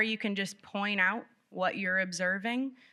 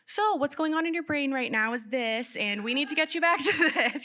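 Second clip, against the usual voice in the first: high, hammed-up over-explaining parent; mock the over-explaining parent, pitched up; lecturing parent; 7 semitones higher, 4 dB louder than usual. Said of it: "to not be like".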